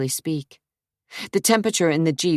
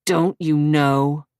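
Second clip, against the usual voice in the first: slow, biting sarcasm; sarcastic; 4 dB louder, slower than usual. Acted. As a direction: drawl it with contempt. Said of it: "he asked sarcastically".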